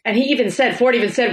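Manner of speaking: normal talking voice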